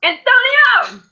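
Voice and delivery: high, loud greeting call; bright shout of a friend's name; excited call. Real, not acted. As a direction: hail a friend joining the call. Calling out to arriving friend.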